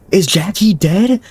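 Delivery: high, slow eerie woman's voice. Acted. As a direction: voice her high and drawn out.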